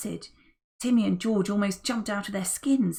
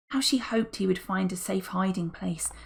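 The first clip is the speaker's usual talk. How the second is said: ordinary delivery, nothing marked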